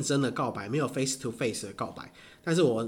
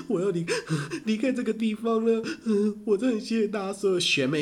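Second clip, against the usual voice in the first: mock crying speech; 7 semitones higher than usual. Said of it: "他就在台上大哭".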